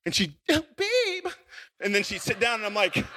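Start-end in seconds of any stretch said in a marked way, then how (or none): 0.5-1.3 very high, hammed-up fright; imitating startled wife. Wife reacts to husband's jump scare, mock startled cry. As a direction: mimic a wife jumping with shock.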